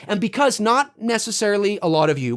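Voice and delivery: ordinary delivery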